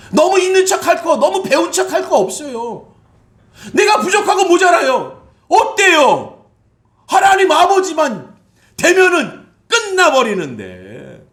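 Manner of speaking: high, loud, slowed shouted sermon; fiery preaching. Acted. Pastor urging humility on the congregation, fiery preaching. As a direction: press the point home.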